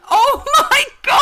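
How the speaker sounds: high, loud laughing exclamation; shrieking with laughter. Real, not acted. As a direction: crack up in scandalised delight. Listener hears sweary child punchline.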